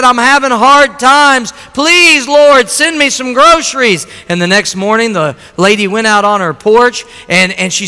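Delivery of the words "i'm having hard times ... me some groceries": high, loud imploring cry; pleading prayer. Retold or imitated. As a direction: beg the Lord as the old lady. Old lady pleading in prayer.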